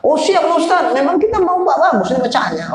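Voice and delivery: high-pitched, quick eager agreement; eager student. Retold or imitated. Congregants assure ustaz they are ready, imitated eagerness. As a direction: imitate a keen pupil promising to cooperate.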